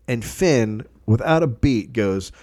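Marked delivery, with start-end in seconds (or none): none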